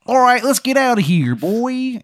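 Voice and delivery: put-on very high, loud Mothman with Maryland accent; hammed-up, high-pitched Maryland creature voice; terrible Maryland accent. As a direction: call to your partner in a bad Maryland accent. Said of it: "He's got, like, a terrible Maryland accent".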